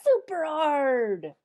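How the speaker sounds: loud slow super hard; emphatic super hard. Shown as a demonstration. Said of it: "Try pushing the button super hard".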